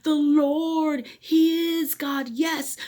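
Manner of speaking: loud, high, slow proclamation; crying out. Acted. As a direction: shout it together in awe. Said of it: "They cried out".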